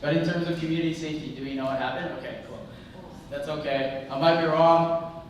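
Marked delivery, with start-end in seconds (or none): none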